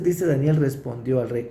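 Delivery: ordinary talk, nothing marked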